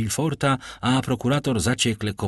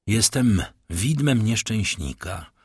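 plain reading voice

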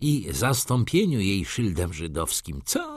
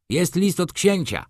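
higher, called announcement; calling out; 9 semitones higher than usual. A narrator's reading. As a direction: call it out across the room. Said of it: "zawołał".

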